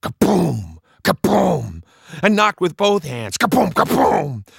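booming knocks voiced out loud; loud knock sound effect. Boy knocking with both hands, booming knock sounds. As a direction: pound out each boom with the mouth.

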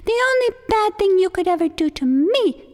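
high, loud turtle voice, feigning fear; a turtle playing his trick; high small turtle voice, mock dread; sly pleading turtle.

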